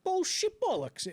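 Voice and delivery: very high, drawn-out mock sailor voice; imitated scoffing lookout. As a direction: ham up the disbelief, slow and squawky. Acts out second lookout scoffing.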